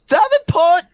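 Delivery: very high, loud call; yelling a name. Acted. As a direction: shout across the room for him. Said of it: "she yells".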